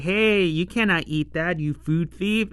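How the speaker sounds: normal talking voice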